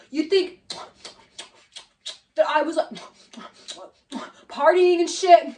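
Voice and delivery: mock talking between big chews, long pauses; imitating loud chewing. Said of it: "he's, like, chewing so loud".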